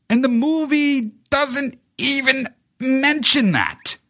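very high, slow, drawn-out disbelief; incredulous outrage. Real, not acted. Host ranting about the movie's omission, high-pitched incredulous. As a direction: squeal it slowly, every word stretched.

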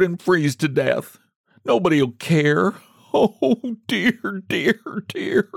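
high, self-pitying lament; wailing. A narrator's reading. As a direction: moan woefully, drawing out each oh dear. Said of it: "clutching her box and wailing".

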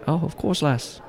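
friendly Irish pub lilt; Irish bartender. Acted. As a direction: play an obliging Irish barman. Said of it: "this strong-built Irishman".

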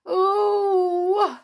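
loud, high, drawn-out "ooh" of fright; frightened groan. A narrator's reading. Pixies under the saucepan hear of witches, frightened groan. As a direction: groan like a crowd of scared pixies. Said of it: "groaned all the pixies in fright".

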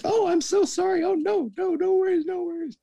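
very high mock-female voice, gushing; flustered apology. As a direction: squeal out a hammed-up embarrassed apology. Mortified woman's apology acted out.

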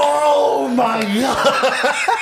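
high, very drawn-out wail; hammed-up laughing shout; exasperated outcry. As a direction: cry out in disbelief while laughing. Acting out his exasperated reaction.

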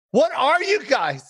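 high, drawn-out indignation; mock outrage. Comic scolding mom and brother over rabbit, mock outrage. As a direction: scold slowly in a high, appalled voice.

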